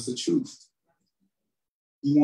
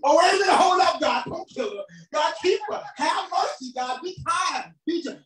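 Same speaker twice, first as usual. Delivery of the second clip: high, urgent backtracking plea; frantic pleading; 13 semitones higher than usual. Acted. Sinner begging God to spare her, frantic pleading. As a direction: blurt it out high, begging God to hold back.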